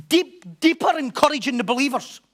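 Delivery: loud and high, pressing each word; emphatic preaching. Real, not acted. Preacher urging the listeners, loud and high. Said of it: "preaching emphasis".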